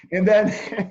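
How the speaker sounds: breaking into laughter while talking; high, loud words pushed through laughter; real laughing. Real, not acted. Astrologer cracking up over their own mishaps, laughing.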